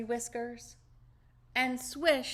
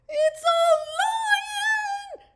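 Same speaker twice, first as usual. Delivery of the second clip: much higher, loud, slow frightened cry; cried in fright; 9 dB louder, much slower than usual. Acted. Fish seeing a lion, crying out in fright. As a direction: shriek it out in terror. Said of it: "cried Ferdinand in fright".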